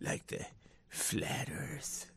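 very soft, hushed mock-ominous whisper; hammed-up spooky whisper; mock conspiracy whisper. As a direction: whisper it like a forbidden secret. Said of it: "You have to say it like that".

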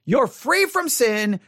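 ordinary talk, nothing marked

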